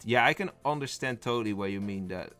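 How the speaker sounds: ordinary talk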